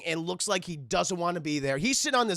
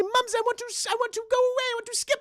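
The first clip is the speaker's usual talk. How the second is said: put-on high-pitched pleading child voice; little rich girl; 14 semitones higher, faster than usual. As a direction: beg Mother like a spoiled little girl. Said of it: "she calls her Mumsy".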